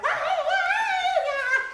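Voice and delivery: high panicked cobweb squeals. Retold or imitated. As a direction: flail like you walked into a cobweb. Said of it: "you're like".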